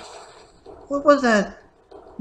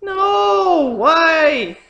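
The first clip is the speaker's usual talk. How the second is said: despairing shout; 5 semitones higher, 4 dB louder than usual. Real, not acted. Losing to Giga Drain.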